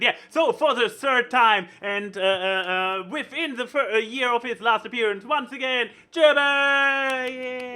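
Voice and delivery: building to a shouted name; announcer hype. Real, not acted. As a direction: hype up the big entrance. Hyping the guest's entrance.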